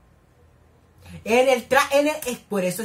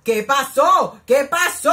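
loud, higher, urgent double question; alarmed outcry; 4 semitones higher, 9 dB louder, slower than usual. Real, not acted. Viewer alarmed by what happened on screen, loud urgent cry.

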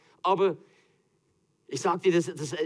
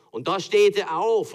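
slow, higher and louder, building the scene; rising drama; 6 semitones higher, 7 dB louder than usual. Acted. Preacher telling of Moses at the sea, dramatic build. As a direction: stand up tall in the telling.

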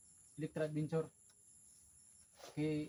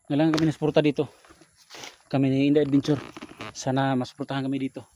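loud plea; 14 dB louder than usual. Acted. Calling out for help.

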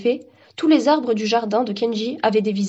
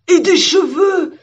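loud, high, drawn-out cheer; overexcited tree butting in; excited exclamation; 8 semitones higher, 8 dB louder, much slower than usual. A narrator's reading. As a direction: burst out loudly with delight. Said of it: "S'exclama l'arbre en face".

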